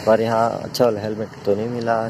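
ordinary talk

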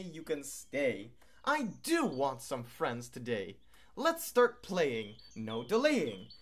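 high, gentle invitation; friendly go-away bird. Acted. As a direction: welcome them back happily.

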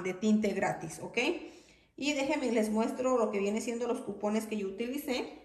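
ordinary delivery

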